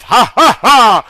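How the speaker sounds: villain laughing at the trapped heroes; evil laugh. Acted. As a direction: cackle it wickedly. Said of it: "laughed with an evil laugh".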